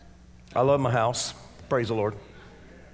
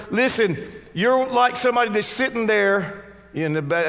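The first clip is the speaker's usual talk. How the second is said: ordinary talk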